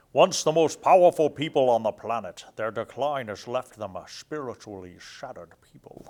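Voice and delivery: booming, then settling, bad Scottish accent; a Sean Connery-ish Scottish read, loud at the start; horrible Scottish accent. Reading dwarf lore aloud in a hammed-up Scottish accent. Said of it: "This is my horrible Scottish accent".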